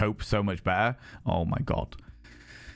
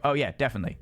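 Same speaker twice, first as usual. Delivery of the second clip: usual speaking voice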